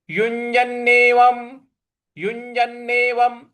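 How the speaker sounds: युञ्जनेवं with the nasal ञ् clearly held; pronunciation demo. Shown as a demonstration. Teacher repeats the corrected word, careful recitation. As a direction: say युञ्जनेवं slowly as a model. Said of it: "युञ् ऐसे बोलेंगे".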